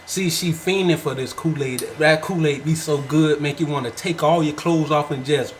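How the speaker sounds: low, soft and level; calm voice. Pretend. Husband brags about his Kool-Aid, calm voice. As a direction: stay deep, smooth and controlled. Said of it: "I gotta do the calm voice".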